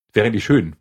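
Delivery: normal talking voice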